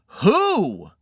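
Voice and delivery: a sharp, hooting "who?"; angry owl hoot. Acted. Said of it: "You shouted "Who?" at me, like a, an, an angry owl".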